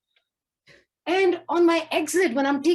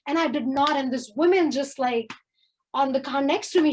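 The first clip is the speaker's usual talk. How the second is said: ordinary delivery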